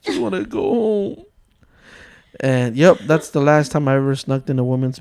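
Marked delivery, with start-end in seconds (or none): 0.0-1.2 high, small whimper; scared teenager. Retold or imitated. Caught teenager wishes he could escape, high and whimpering. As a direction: plead in a mock-frightened little voice.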